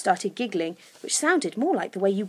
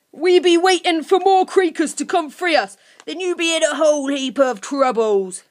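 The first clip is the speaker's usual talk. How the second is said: high, loud creature threat; louder threat; 7 semitones higher, 6 dB louder than usual. A narrator's reading. Grunt warning Lucy of more creakers, loudly. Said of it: "More loudly, he added".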